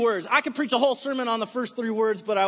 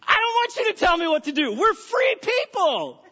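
much higher, louder indignant shout, "free people" stretched out; mock defiant American; 10 semitones higher, 6 dB louder than usual. Americans refusing to be told what to do, mock shout. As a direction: play the stubborn citizen yelling back at God. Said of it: "as Americans, we go".

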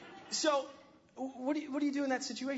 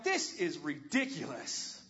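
fed up; 8 semitones lower, much slower than usual. Retold or imitated. Exasperated quote while fixing sink.

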